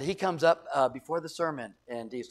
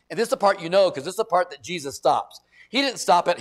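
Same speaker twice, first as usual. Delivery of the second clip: ordinary talk, nothing marked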